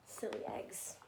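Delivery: ordinary delivery, nothing marked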